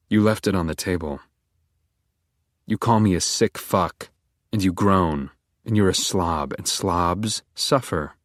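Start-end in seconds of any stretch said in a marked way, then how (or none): none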